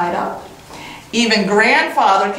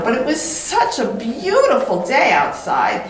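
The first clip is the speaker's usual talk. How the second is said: ordinary delivery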